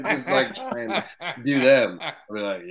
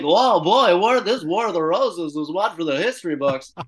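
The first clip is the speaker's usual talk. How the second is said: radio host voice; 6 semitones higher, 5 dB louder than usual. Retold or imitated. Radio host wraps up the segment.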